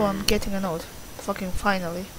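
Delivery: ordinary talk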